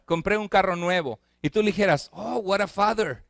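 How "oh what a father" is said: American speaker. Retold or imitated. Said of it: "que un americano dijeran".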